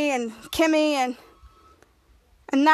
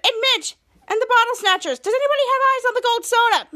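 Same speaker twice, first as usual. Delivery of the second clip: raised pitch, urgent call; 7 semitones higher, 3 dB louder than usual. Acted. Shadow asks the room about the gold soda, calling out. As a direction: call across a dark room. Shadow calls out after lights go out.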